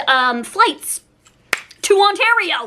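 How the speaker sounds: usual speaking voice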